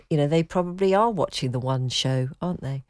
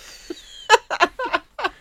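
a sudden, high, real laugh; high burst of laughter. Real, not acted. Co-host cracks up at being teased, laughing high.

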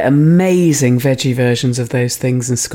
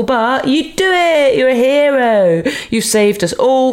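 excited; 9 semitones higher than usual. Acted. Triumphant climax of the scenario.

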